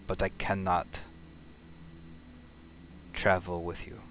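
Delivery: subdued, halting, with long pauses; understated resignation, give in reluctantly; resigned. Acted. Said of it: "just kind of this resigned sigh".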